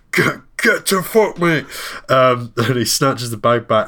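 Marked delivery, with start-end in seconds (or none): 0.1-1.7 a high, snapped mock threat; imitating a high snarl at a bag thief; snapping in a high put-on voice. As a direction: bark it back at the mugger in a high put-on voice. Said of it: "snaps in".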